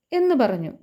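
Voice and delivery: normal talking voice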